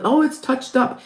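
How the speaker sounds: ordinary talk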